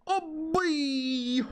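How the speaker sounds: excited. Real, not acted. Reacting to happy character.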